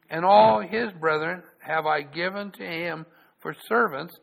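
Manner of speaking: loud, measured Bible reading; solemn public reading of scripture. Acted. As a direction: read it out like the pulpit Bible.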